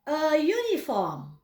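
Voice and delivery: a uniform said slowly, "a yuniform"; slow model pronunciation. Shown as a demonstration. Teacher models a uniform for students to repeat.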